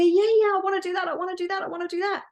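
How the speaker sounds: high, bouncing eager yeah yeah; a thrilled child jumping at the chance; little light jumps at the chance, high and thrilled; very excited. Acted. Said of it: "gets very excited".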